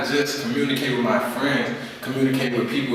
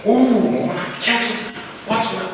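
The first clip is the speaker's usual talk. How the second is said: higher-pitched threat, slowed and drawn out; mock angry threat; 9 semitones higher, slower than usual. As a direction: play the hothead vowing revenge. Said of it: "that was the old me".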